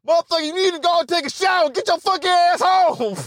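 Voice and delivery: hammed-up boss ordering him out; bossy manager. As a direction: play the boss sending a worker home. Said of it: "imagine the power as a boss".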